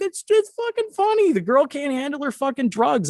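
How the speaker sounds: high-pitched, incredulous self-defense; protesting. Retold or imitated. Defending the laughter to roommate, protesting. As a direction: plead the case in a squeaky retold voice.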